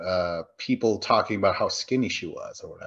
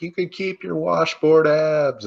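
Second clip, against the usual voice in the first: drawn-out, higher taunting call; mock yelling across a lake; 7 semitones higher than usual. Camper taunting the rival camp across the lake, mock yelling. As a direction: call it out as if to the far shore. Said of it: "they start yelling at Camp M V P".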